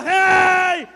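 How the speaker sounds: long, high yelled "hey"; shouted hey. Acted. Blind man making a racket for attention, drawn-out yell. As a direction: holler to get attention at full volume. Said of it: "él gritaba".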